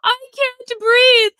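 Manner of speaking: high, drawn-out mocking wail; mock crying. Streamer mimicking arrested immigrant at airport, mock crying. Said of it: "she was crying. She's like".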